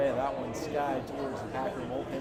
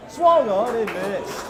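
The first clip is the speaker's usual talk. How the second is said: excited call; 8 semitones higher, 12 dB louder, slower than usual. Real, not acted. Strikeout call in broadcast.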